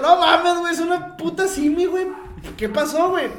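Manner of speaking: high, loud disbelieving rant; exasperated shout. Retold or imitated. Narrator yelling at his friend over a pharmacy, loud. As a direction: yell at him in disbelief.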